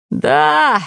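high, drawn-out yawny yes; mid-stretch, pitch lifted. A narrator's reading. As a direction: say it through a lazy stretch. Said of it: "потягиваясь".

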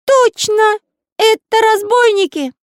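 very high, loud confirmation; high-pitched cartoon certainty; excited Pencil character. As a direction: exclaim it in a piping voice.

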